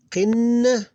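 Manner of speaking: "Qin" with the n held long; one held syllable. Shown as a demonstration. Teacher showing one syllable, "Qin" sustained on n. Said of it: "That's one syllable".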